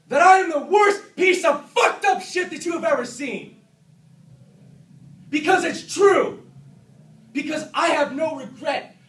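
screaming in self-loathing rage; furious shout. Acted. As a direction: yell it out with raw fury. Screaming that he's a monster.

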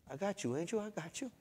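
high, soft, soothing voice; gently calm him down; retold reassurance. Teacher settling the frustrated trainee, softly reassuring.

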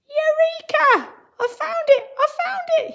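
very high, loud triumphant cry; excited shout. A narrator's reading. Teacher reading story, Mister Archimedes shouting his discovery. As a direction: shout it out in sheer delight. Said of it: "he shouted".

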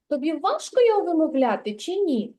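ordinary talk

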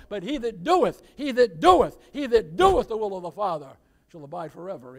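loud, rhythmic scripture proclamation; fervent preacher reading John aloud; full-force preaching. Acted. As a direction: hammer each line home from the pulpit.

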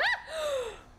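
sharp, high startled gasp; a real gasp of shock as you fall. Real, not acted. Sudden fall in the game.

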